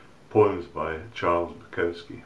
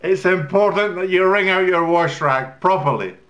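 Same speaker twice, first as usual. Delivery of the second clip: high, loud wifely lecture; nagging wife; 12 semitones higher, 9 dB louder than usual. Acted. Wife lecturing husband about the wash rag, high loud nagging. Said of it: "she says".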